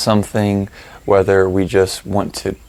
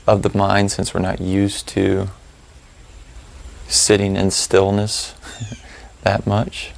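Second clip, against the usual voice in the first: calm, spacious delivery, letting each phrase settle; guided meditation; 8 dB quieter than usual. Real, not acted. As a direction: lead them through the breathing, unhurried and even.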